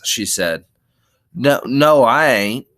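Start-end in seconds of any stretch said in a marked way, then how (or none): 1.3-2.7 earnest, slightly louder protest. Acted. Lenny protesting to Curly's wife, earnest. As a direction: insist on it sincerely. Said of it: "Lenny explained earnestly".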